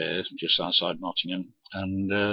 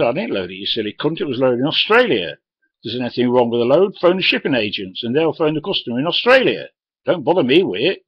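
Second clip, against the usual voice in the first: loud, higher furious telling-off; retold angry shout; 4 semitones higher, 8 dB louder than usual. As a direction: yell him down, red-faced. Driver shouting at the bloke.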